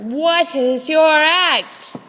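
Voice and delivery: loud, slowed-down ringmaster; slow emphatic repeat. Acted. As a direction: spell it out slowly, losing patience.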